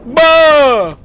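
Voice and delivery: loud, high "bah"; short high-pitched burst; mock scare shout. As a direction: yell a sudden boo. Bracing for a jump scare.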